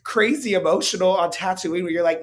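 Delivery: loud, fed-up mock rant; exasperated outburst. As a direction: throw up your hands and demand some feeling. Exasperated at the character.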